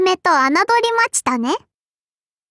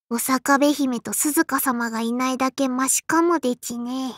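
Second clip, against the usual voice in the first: low, subdued muttered complaint; weary grumble; 5 semitones lower than usual. Acted. Grumbles about the kitchen's problem children.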